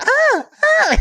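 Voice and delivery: very high-pitched moans; high hammed-up moaning; mock sexual moans. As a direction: imitate a woman's exaggerated moaning. Imitates woman in porn.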